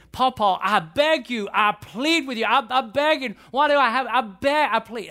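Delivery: high, insistent mock begging; pleading granddaughters. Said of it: "were to come to me and were to say".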